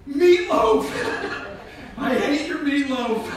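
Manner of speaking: loud, high laughing blurt; hammed-up confession yelled through laughter; blurted outburst. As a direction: shout it out, half laughing. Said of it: "And I went".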